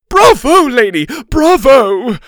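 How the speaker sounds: high, very loud bravos; cheering. A narrator's reading. Teacher giving a standing ovation, loud high cheer. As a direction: cheer at full volume, pitched high. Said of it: "Missus Arthur cheered".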